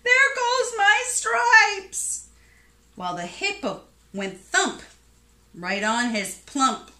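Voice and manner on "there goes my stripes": very high, loud, drawn-out yelp; zebra's yell. A narrator's reading. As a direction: wail in cartoon alarm, stretching the words. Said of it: "The zebra yelled".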